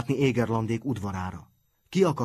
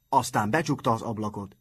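plain reading voice

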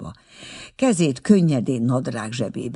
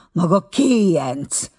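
slow, loud accusing cry; angry outburst; 5 semitones higher, 5 dB louder, slower than usual. A narrator's reading. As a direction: hurl the insult at him, louder. Furious girl insults him.

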